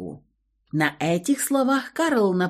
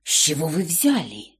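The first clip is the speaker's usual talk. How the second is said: scared whisper; 3 semitones lower than usual. Acted. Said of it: "испуганно прошептал Федя".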